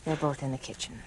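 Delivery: murmured warning; hushed. Acted. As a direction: keep it down so they won't hear. Keeping voice down.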